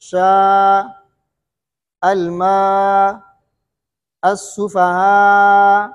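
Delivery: slow chanted recitation of सुफहा, its final vowel drawn out; medium vowel stretch. Shown as a demonstration. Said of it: "तवस्सुत होगा".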